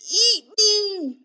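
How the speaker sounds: high, loud panicked bee; frightened cry. Acted. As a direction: wail in storybook terror. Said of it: "he cried".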